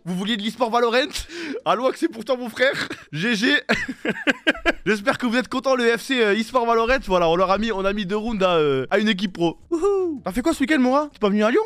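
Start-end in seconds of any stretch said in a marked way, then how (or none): 3.6-4.8 squealing laugh, far up in pitch; a high, giddy burst of laughter; shrill high laugh. Real, not acted. Celebrating the two rounds taken off the pro team, squealing laugh. Gloating over the win. 9.7-10.2 cheer. Real, not acted. Celebrating the win.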